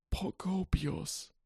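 put-on high, soft old wizard voice, slow recitation; softly reciting old wizard. A narrator's reading. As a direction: murmur it like ancient verse. Dumbledore voiced in story.

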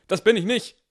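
high, loud panicked blurt; panicky cry. A narrator's reading. Student calls from his seat to the teacher, panicked. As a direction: blurt it out fast to stay out of it. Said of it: "Er klang leicht panisch".

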